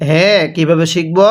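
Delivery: normal talking voice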